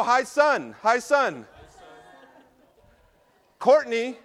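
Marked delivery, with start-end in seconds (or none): 0.0-1.5 greeting the sun. Shown as a demonstration. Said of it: "everyone go".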